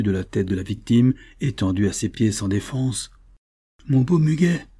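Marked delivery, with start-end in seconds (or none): 3.9-4.7 raised, higher mock-threatening cry; thundering voice. A narrator's reading. Boatman threatening the waking stranger, thundering. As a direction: bellow it over the helpless victim. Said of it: "lui cria-t-il d'une voix de tonnerre".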